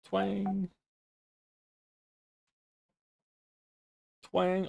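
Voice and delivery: high, playful twang noise; imitated twang. Imitating the missing twang sound for chat, high and playful. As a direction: chirp the word like the sound itself.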